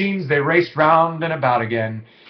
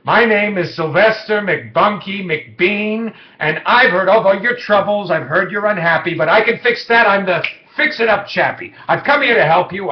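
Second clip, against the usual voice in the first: lightly voiced showman, a bit higher and louder; a hint of a fast-talking huckster; clear clean salesman voice; 5 semitones higher, 5 dB louder than usual. A narrator's reading. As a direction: pitch it like a slick traveling salesman. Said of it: "in a voice clear and clean".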